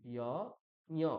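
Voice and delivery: ইয় nasalised and a bit slow; nasal y. Shown as a demonstration. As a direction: add the nose to ইয়. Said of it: "নাক যোগ করে দিচ্ছি".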